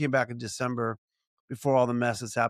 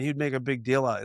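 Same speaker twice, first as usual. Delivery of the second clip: ordinary talk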